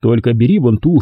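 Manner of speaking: ordinary delivery, nothing marked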